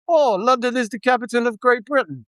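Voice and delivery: high-pitched recital voice; imitated cab driver. Cab driver answering a foreign passenger from London, imitated high voice. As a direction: mimic a local reciting a learnt English phrase.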